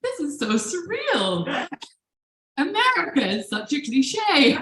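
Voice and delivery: high-pitched, giddy amazement; acted inner squeal of glee; totally thrilled. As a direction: marvel in delighted disbelief.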